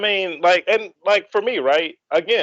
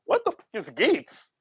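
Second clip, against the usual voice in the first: retold bafflement; 6 semitones higher than usual. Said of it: "I have no idea what's going on".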